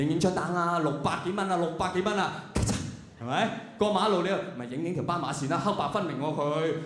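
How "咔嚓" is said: crisp voiceless shutter click; imitated camera shutter. Snapping photos on the phone, imitated shutter. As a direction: click like a camera taking a snap.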